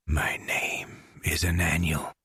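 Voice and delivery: a soft, eerie whisper; a disturbed man whispers his strange new name. A narrator's reading. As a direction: breathe the name like a secret. Disturbed man answers old colleague.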